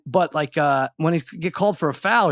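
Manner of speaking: ordinary talk, nothing marked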